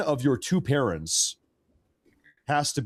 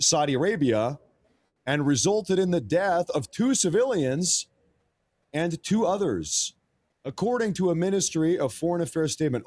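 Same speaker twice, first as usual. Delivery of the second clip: news read; 7 semitones higher than usual. Acted. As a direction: read it out like a wire report, deliberately. Reads out a news statement.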